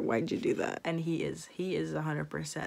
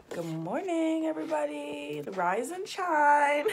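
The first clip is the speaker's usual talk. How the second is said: high, drawn-out singsong greeting; singsong wake-up call; 7 semitones higher, slower than usual. Pretend. Vlogger waking up her viewers, high singsong. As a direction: playfully sing out a morning wake-up.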